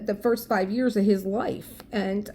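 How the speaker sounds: normal talking voice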